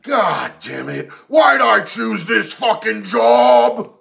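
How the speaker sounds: slow, throat-straining rant of a fed-up guard; C O Stevens yell. Acted. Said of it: "That's as much as I can yell".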